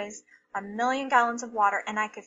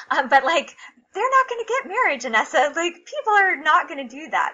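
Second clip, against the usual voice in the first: raised, higher voice, pushing her point; replaying the argument with her sister, louder and higher; heated argument; 6 semitones higher, 6 dB louder than usual. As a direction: insist loudly, mid-argument. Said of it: "I was just saying".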